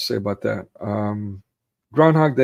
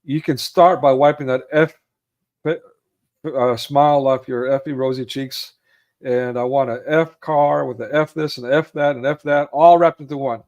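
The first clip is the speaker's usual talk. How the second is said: louder, fed-up tirade; annoyed rant; 3 semitones higher, 6 dB louder than usual. Retold or imitated. Annoyed traveler ranting at rental counter clerk, loud. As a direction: unload on the clerk. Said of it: "And he's annoyed".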